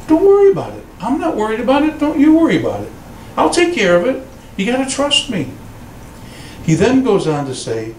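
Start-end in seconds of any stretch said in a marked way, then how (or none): 0.1-5.5 reassuring Lord. Retold or imitated. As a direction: comfort a worried child. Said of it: "'cause He says".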